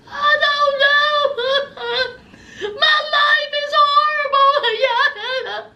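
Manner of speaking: high, slow, hammed-up wailing sob with laughs; mock drunk sobbing. Host mocking drunk Ashley's wail over her shoes, mock sobbing. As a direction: whine it like a drunk crying woman, half laughing. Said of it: "crying, snot flying".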